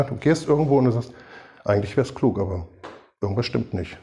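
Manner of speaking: ordinary delivery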